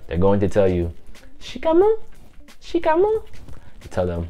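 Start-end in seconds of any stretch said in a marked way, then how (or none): 1.4-3.3 put-on high little child's greeting; kids' voices. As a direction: squeak it up like small kids. Kids greeting, imitated.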